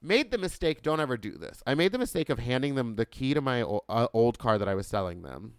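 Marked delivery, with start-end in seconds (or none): none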